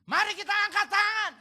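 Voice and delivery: loud, high call to raise hands; hammed-up loud worship cue; mock song leader shout. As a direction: belt it like a worship leader on stage. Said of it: "dia teriak lagi".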